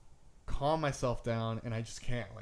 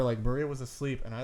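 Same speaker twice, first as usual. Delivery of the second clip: usual speaking voice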